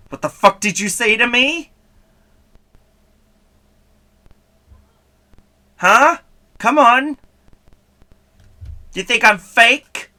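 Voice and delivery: loud, high indignant challenge; mock confrontational. Streamer challenges a viewer who called her fake, mock aggressive. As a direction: square up in pretend outrage.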